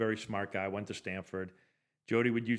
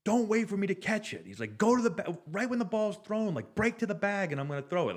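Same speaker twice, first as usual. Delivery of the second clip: much higher, louder heated rant; heated yelling; 11 semitones higher, 5 dB louder than usual. Retold or imitated. Catcher chewing out teammate in the dugout, heated yell. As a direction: bark the instructions like a fired-up catcher. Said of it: "he was very heated".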